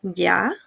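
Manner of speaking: "Ya" dipping low, then rising; unsure third tone. Shown as a demonstration. Teacher imitating someone not sure of something, dipping-rising "Ya". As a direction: draw out "Ya" doubtfully, down and up. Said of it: "no estar muy seguro de algo".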